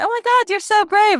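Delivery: high, loud gushing; gushing praise. Retold or imitated. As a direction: gush over someone's bravery. Retelling everyone gushing.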